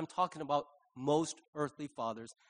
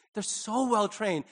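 usual speaking voice

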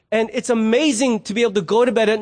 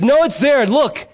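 higher-pitched, mildly impatient retort; insisting wife; 6 semitones higher, 4 dB louder than usual. Acted. Wife answers husband at the refrigerator, mock insistent.

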